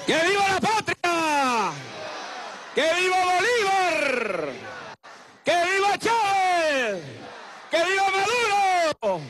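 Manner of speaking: high, shouted rally cries; booming balcony speech. Real, not acted. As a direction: whip up the crowd with each viva. Rally chants to crowd.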